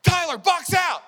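high, loud, slow yell; teammate shout. Retold or imitated. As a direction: bark an order across the court. Teammates yelling at him.

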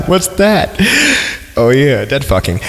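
usual speaking voice

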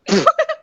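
sudden high shriek melting into giggles; shriek, then burst into giggles; scream into giggles. Real, not acted. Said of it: "You scared the man".

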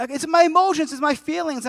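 high-pitched imitated whine; mock crying. Wife explaining to her husband, mock weepy. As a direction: plead tearfully as the upset wife. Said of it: "She was crying".

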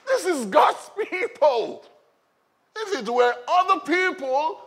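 high, slow, indignant shout; outraged champion defending his people; angry. Acted. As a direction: hurl it slowly at the enemy in righteous anger. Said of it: "He was angry in his spirit".

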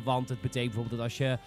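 usual speaking voice

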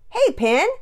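put-on loud, high boy's call; bright-pitched shouted hail to a sister; shouted greeting. A narrator's reading. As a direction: holler hello across the house. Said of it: "Pearson shouted as she walked into".

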